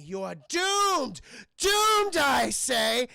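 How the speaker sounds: loud, high villain proclamation; theatrical doom cry. Retold or imitated. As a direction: bellow a hammed-up prophecy of doom.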